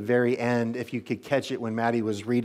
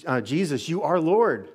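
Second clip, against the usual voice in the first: raised, earnest declaration of faith, lifted higher and louder; sincere declaration; 5 semitones higher, 4 dB louder than usual. Shown as a demonstration. Pastor modeling a confession for the congregation, lifted earnest declaration. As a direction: proclaim it like you mean it. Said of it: "where you might just say".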